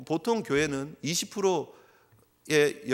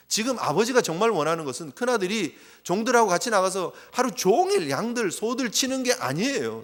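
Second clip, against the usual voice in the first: ordinary talk, nothing marked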